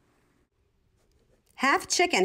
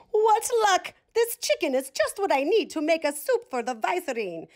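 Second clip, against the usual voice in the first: much higher, gleeful cook voice; delighted cook; 8 semitones higher than usual. A narrator's reading. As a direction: crow with glee over your luck, high. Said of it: "The cook who saw him said".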